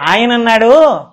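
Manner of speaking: ordinary talk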